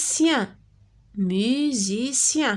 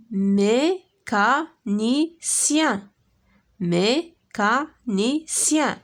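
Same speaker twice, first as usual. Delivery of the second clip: mécanicien drawn out very slowly, each syllable held; stretched syllables; much slower than usual. Shown as a demonstration. Teacher modelling a word slowly for pupils. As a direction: stretch mécanicien so the ien ending rings out. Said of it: "Écoute et repère le son".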